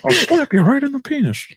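high-pitched mock threat; silly squeaky tough talk; high-voiced James Bond. High-voiced Bond threatening a foe, hammed up high. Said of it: "a high-voiced James Bond".

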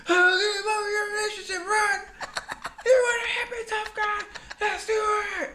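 very high, loud screamed challenge; mock screaming fight. Friend re-enacting Jersey Shore brawl, high mock scream. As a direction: scream it hysterically at top pitch.

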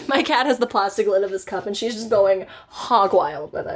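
usual speaking voice

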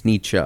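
Nietzsche ending in "-cha"; corrected name pronunciation. Shown as a demonstration. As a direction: say Nietzsche as "NEE-cha", with a final uh sound. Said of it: "he gets very upset".

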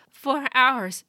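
exhausted rider answering; hoarse messenger. A narrator's reading. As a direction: answer wearily.

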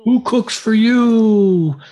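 high, slow hooting owl voice; barred owl call. Retold or imitated. Imitating the barred owl for a caller, high slow hoot. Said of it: "He was saying".

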